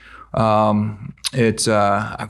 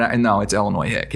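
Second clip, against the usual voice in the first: ordinary delivery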